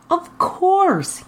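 high, beaming reply; a proud, beaming yes. Acted. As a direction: grin through it, delighted. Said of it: "he beamed".

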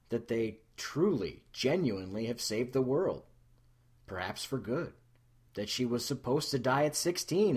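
usual speaking voice

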